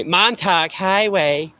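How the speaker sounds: Montauk slow, with an aspirated T and a flat "tahk"; drawn-out outsider Montauk, "Mon-TAHK"; how some people say it. Shown as a demonstration. Mocking how others say Montauk, slow and odd. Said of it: "as some people say".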